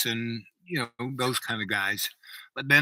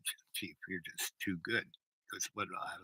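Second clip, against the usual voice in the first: hushed confiding; 4 semitones lower, 10 dB quieter than usual. Retold or imitated. Confiding request to the chief.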